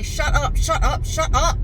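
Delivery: a mock outburst at someone who won't stop; exasperated. Wanting to shut up the chatty caller, exasperated. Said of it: "wanting to just say".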